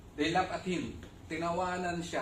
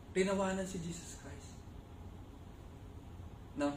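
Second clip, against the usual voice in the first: ordinary talk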